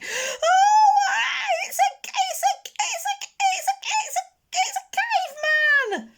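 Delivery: put-on very high, stammering terrified child; sobbing and screaming. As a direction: scream while fleeing in panic. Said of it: "sobbing and screaming".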